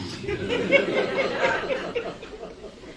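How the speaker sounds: a long high airy laugh; high breathy laugh. Real, not acted. Speaker laughs to the audience after quoting his wife, long high laugh.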